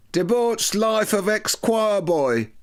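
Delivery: high, slow, hammed-up headline voice; mocking tabloid headline. Narrator mocking a tabloid headline, slow and high. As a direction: sneer out the headline like a gloating paper. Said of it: "drooled the Evening Standard headline".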